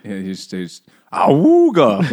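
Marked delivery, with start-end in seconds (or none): 1.1-2.1 high, loud "Awooga" horn honk; imitating an old crank car horn. Imitating the horn of Toad's motor car, loud honk. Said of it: "went the horn".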